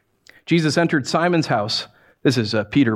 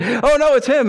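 mock alarmed crowd; 8 semitones higher, 4 dB louder than usual. Crowd recognizing the demon.

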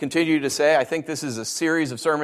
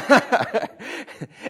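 breathless laugh; 7 semitones higher than usual. Real, not acted. Laughs at his own joke.

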